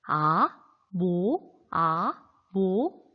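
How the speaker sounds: “아”와 “모”를 낮게 시작해 끌어올리기; “아, 모”를 미에서 솔로 각각 올리기. Shown as a demonstration. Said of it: "미에서부터 솔까지". (끝이 쭉 올라가는 이성 “아, 모”)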